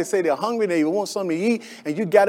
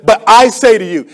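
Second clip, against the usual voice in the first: authoritative voice; 4 semitones higher, 9 dB louder than usual. Retold or imitated. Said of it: "Jesus spoke with such authority".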